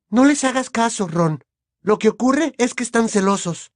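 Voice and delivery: put-on very high girl's voice; Hermione advising. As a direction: reassure a friend in a high-pitched girlish voice. Narrator plays Hermione. (voz aguda de chica que aconseja a su amigo)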